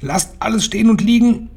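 high, shrill witch yell; urgent high-pitched shouted order; piercing shout. A narrator's reading. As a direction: ring out like a trumpet. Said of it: "Ihre gellende Stimme scholl wie eine Trompete".

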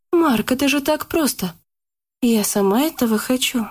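insisting. A narrator's reading. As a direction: press him, urging him on. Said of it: "настаивала Яна".

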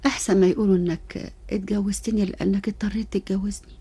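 normal talking voice

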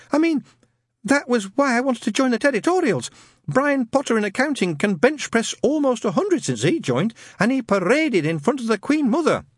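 high, polite, timid lad; nervous young man. Acted. Newton asking Shadwell about the ad, timid and high. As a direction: ask shyly, voice up.

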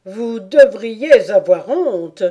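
loud, slow scolding; griffon indigné. A narrator's reading. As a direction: shame her indignantly. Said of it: "avec une fureur indignée". (griffon qui gronde, indigné)

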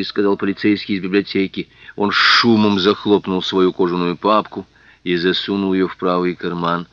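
usual speaking voice

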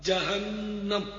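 "جہنم" very slowly as jahannam; stretched careful "جہنم" ending with am; correct Arabic pronunciation. Shown as a demonstration. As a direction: hold the double n in "جہنم", end -nam. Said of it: "یہ عربی لفظ ہے".

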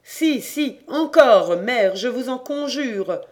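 loud, high begging; imploring. A narrator's reading. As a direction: implore her to keep going. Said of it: "disait la voix de son enfant". (supplication forte et aiguë)

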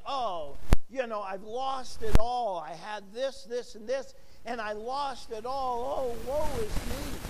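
lightly hammed-up woe-is-me; mock lament. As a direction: lament as if everything is lost, half playfully. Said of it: "in the midst of calamity".

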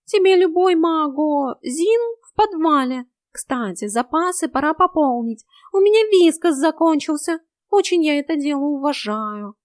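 high, loud cat voice; hint at food as a cat; high-pitched cat chatter; wheedling cat. A narrator's reading.

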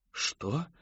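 hushed, rising question; disbelieving. A narrator's reading. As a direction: ask it softly in disbelief. Mother cannot believe her ears.

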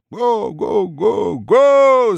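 booming chanted ho-ho; class thunders. A narrator's reading. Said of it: "загремел класс".

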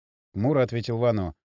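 ordinary delivery, nothing marked

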